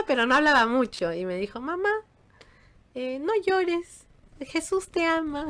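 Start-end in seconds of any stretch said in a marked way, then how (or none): 1.6-5.4 put-on little girl voice. Toddler comforting her crying mother, high and gentle. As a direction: speak as a tiny girl soothing her mom.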